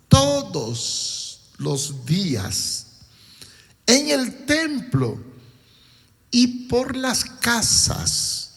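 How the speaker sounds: slow, emphatic reading of the verse; preaching. Acted. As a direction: stretch each phrase to drive it home. Predicador enfatiza el versículo. (predicación enfática y lenta)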